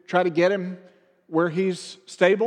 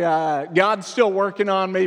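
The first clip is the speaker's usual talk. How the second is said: ordinary talk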